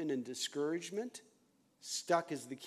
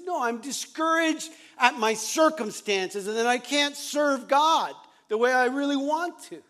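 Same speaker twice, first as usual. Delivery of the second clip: insistent protest; 9 semitones higher, 11 dB louder than usual. Retold or imitated. Insisting to counselor, retold argument.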